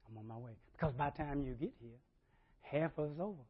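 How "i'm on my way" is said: mock latecomer.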